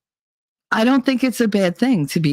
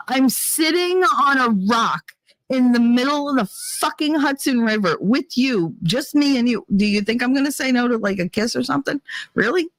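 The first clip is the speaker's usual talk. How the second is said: higher-pitched incredulous comeback; retold exasperation; 7 semitones higher than usual. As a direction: spell out the obvious, pitched up in disbelief.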